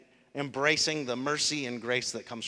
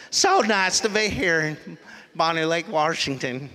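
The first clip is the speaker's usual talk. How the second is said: put-on country accent; 2 semitones higher, 5 dB louder than usual. Pastor mimicking his own greeting at church in a country accent. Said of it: "the country accent came from when we entered".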